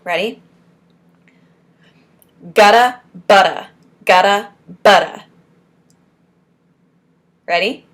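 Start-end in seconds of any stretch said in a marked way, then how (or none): 2.4-5.2 loud, punched syllables; forceful articulation drill; strong from the diaphragm. Shown as a demonstration. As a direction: project each word firmly from the diaphragm. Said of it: "say it with your diaphragm strong".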